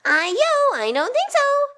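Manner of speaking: defiant rice cake cry. A narrator's reading. Rice cake escaping the knife, high defiant cry. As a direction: cry out as a cheeky runaway cake. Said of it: "It cried".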